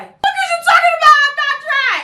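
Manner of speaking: high, loud disbelieving shriek; outraged shriek. Retold or imitated. Customer shrieking back at the stylist who says she isn't dry, outraged. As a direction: shriek it like you can't believe her.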